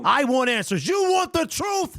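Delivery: hammed-up demand for the truth; playful mock lawyer shouting back at the clip; movie courtroom shout. Host reenacts movie courtroom lines.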